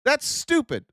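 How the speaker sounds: high mocking yell at a troll. Shown as a demonstration. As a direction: shout it down like a bully.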